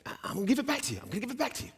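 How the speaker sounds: very high, hurried stammering assurance; nervous promise. Retold or imitated. Eddie promising to repay the pastor, nervous and high. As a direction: imitate an anxious man in a squeaky high voice. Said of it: "kinda nervously shaking".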